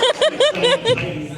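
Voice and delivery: high shrieking Titans cry; mock titan roar. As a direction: scream it out like a giant monster. Screaming Titans at climax.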